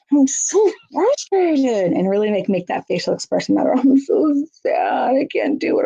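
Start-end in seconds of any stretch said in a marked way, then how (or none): 0.1-1.9 high, slow, overdone frustrated whine; exaggerated frustration. Shown as a demonstration. Parent modeling frustration for a child: exaggerated slow whine. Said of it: "we might go". 3.7-5.8 high, slow, overdone sad voice; exaggerated sadness. Shown as a demonstration. Parent showing sadness to a small child: exaggerated sad voice. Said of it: "we might go".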